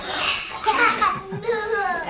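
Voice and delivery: high playful laugh after a mock gasp; surprised laugh. Shown as a demonstration. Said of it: "make a surprise face".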